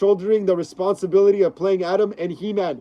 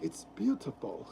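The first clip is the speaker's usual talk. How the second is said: hushed, low awestruck villain; very soft mock-reverent murmur; Director Krennic; 4 semitones lower, 15 dB quieter than usual. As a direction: barely breathe it out, savouring the sight. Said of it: "like when director Krennic is looking at".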